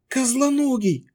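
high startled cry; shocked outburst. A narrator's reading. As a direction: blurt out the name in shock. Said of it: "вырвалось у Тави".

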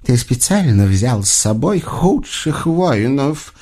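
slow, pursed-lip disdainful question; puzzled witch. A narrator's reading. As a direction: ask it slowly, baffled at such incompetence. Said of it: "недоумённо поджала губки ведьма".

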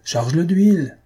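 a voice from the crowd calls out high and loud; shouted heckle. Acted. Theatre heckler shouting at the actress from the crowd. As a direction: heckle the stage at full voice. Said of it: "crie une voix".

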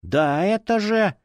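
high, drawn-out indignant whine. A narrator's reading. Fanatic objects to being chided, indignant. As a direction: protest as if wronged. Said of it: "возмутился фанатик".

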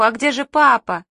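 mild yell of alarm. A narrator's reading. Children looking for father, mildly alarmed yell. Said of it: "орали мы сверху".

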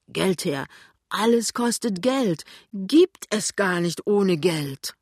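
indignant rant. A narrator's reading. Husband rants to wife about costs, indignant rant. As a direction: rail against it, item after item.

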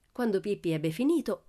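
plain reading voice

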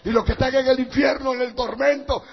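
usual speaking voice

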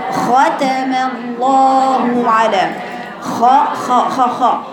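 ختم with a rasping خ from the throat; throat kha. Shown as a demonstration. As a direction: drag out the خ of ختم. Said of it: "شوفي صوت وجريان صوتها". (خاء من الحلق)

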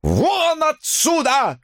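high, drawn-out bellow; enraged old man throwing her out; furious yelling. A narrator's reading. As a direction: roar at her to leave. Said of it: "орал он".

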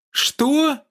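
very high-pitched, incredulous exclamation; indignant outcry. A narrator's reading. Даша reacts to being suspected of murder, indignant outcry. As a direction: cry out in outraged disbelief. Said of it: "выкрикнула Даша возмущённо".

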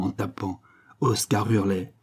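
usual speaking voice

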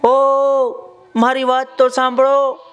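crocodile shouting high and loud to the farmer; calling out. Acted. Crocodile calling out loudly to the passing farmer. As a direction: call out across the pond, high and loud. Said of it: "એણે તરત જ તેને બૂમ પાડી".

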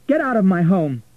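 loud, high, furious command; outraged order. A narrator's reading. Florentina ordering Richard out, outraged and loud.

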